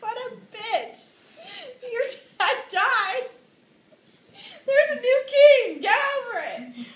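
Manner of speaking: high, loud hammed-up mother voice; scolding mom. Hamlet's mom telling him off, high mock scolding. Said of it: "that's basically what his mom says".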